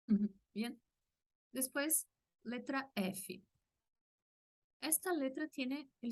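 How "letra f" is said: usual speaking voice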